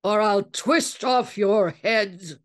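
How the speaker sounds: a full bellowed threat; angry bellow. A narrator's reading. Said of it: "his eyes turned red with anger as he bellowed".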